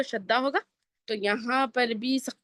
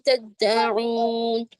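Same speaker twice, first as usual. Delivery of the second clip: very slow, drawn-out melodic tajweed; a teacher modelling the verse for students; Quranic recitation; much slower than usual. Shown as a demonstration. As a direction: stretch every vowel like a reciter.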